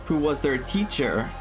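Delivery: normal talking voice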